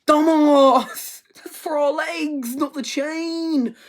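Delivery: hammed-up exasperated scolding; mock yell. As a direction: scold him through a laugh. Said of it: "the other one's like".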